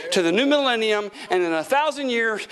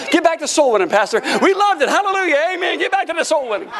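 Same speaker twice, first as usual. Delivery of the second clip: high, raised-voice mock cheering; cheering congregation; 6 semitones higher, 4 dB louder, faster than usual. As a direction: urge the pastor on like an eager crowd. Mimics congregation cheering pastor on.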